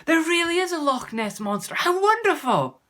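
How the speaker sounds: high, loud exclamation; excited boy. Acted. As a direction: burst out with the thrilling realisation. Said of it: "slapped his thigh excitedly".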